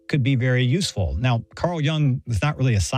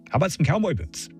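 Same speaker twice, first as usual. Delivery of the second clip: lightly hammed-up request, pitched higher; higher, quick punchline delivery; playing up Texanness; 5 semitones higher, faster than usual. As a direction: toss it out as a playful quote of yourself. Quoting self playing up Texanness.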